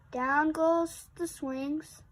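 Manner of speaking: usual speaking voice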